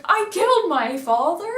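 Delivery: high, loud horrified disbelief; shocked question. Retold or imitated. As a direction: gasp it out in horror. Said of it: "so he's like".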